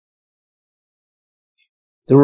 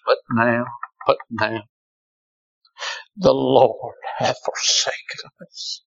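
hushed, lower, half-whispered despair; forsaken lament; 4 semitones lower, 6 dB quieter than usual. Retold or imitated. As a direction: let it fall like a broken man. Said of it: "listen what he said".

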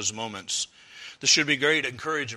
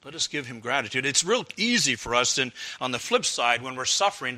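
ordinary talk, nothing marked

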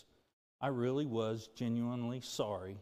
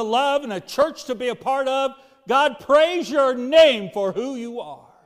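louder and higher, ringing with praise; fervent praise; 9 semitones higher, 14 dB louder than usual. Real, not acted. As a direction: lift it up, full-voiced worship. Prayer swells into praise.

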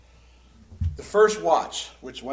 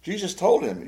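ordinary delivery

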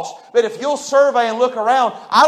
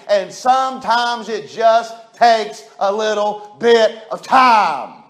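ordinary talk, nothing marked

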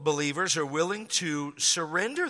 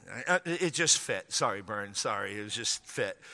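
softer, lower apology off the sermon line; quiet aside; 8 semitones lower, 7 dB quieter than usual. Real, not acted. Preacher apologising to Bern, a soft lowered aside. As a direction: drop your voice to say sorry.

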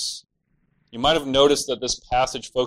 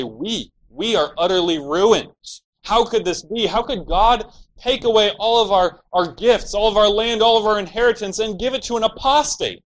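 higher and louder, a wail of outraged disbelief; panicked disbelief; 9 semitones higher, 6 dB louder than usual. Retold or imitated. Land robbers protesting God's justice, loud panicked wail. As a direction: cry it out like a robber losing everything. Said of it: "respond in panic disbelief".

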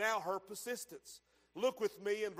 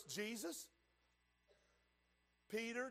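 ordinary talk, nothing marked

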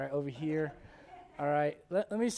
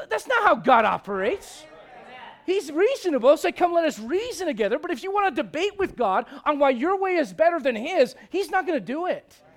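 high, loud pulpit delivery; forceful preaching; 10 semitones higher, 12 dB louder than usual. Real, not acted. Preacher correcting those who debate God, high and forceful. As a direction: drive the point home, raised and emphatic.

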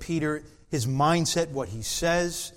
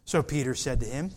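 ordinary talk

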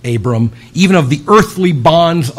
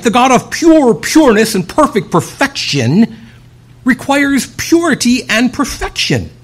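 normal talking voice

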